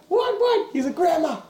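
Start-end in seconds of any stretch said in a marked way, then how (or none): 0.1-0.7 put-on much higher, fluttery old lady asking; mock frail grandma. Grandma voiced in story. 0.9-1.4 higher, laughing pleading call; mock panicked plea. Pinned man calls grandma.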